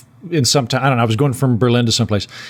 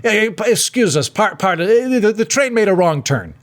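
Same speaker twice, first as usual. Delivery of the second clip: higher, halting mock Italian conductor; attempted Italian accent; 5 semitones higher than usual. Italian conductor excusing the train to a passenger, attempted accent. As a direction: fumble through a bad Italian accent. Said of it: "I, I, I can't do the accent".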